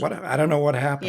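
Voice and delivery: froggy, gravelly throat; hoarse with a tickle; whiskey voice. Real, not acted. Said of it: "You sound froggy".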